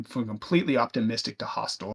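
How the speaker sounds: normal talking voice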